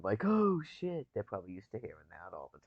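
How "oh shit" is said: higher, louder startled "Oh, shit"; mock startled curse. Imitating someone spotting a mouse, mock startled curse. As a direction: jump at it like you just saw a mouse. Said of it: "mice are probably used to hearing".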